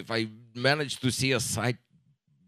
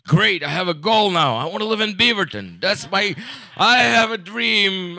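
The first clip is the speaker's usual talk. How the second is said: louder, higher, a hammed-up inspired vow; mock inspired dreamer; 5 semitones higher, 8 dB louder than usual. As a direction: play the earnest dreamer announcing a life goal. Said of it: "wake up and like".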